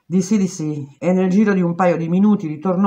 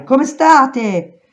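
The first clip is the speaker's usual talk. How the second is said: shouted; 8 semitones higher, 6 dB louder than usual. A narrator's reading. Prisoner shouting to women behind the wall, loud. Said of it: "questa volta gridai".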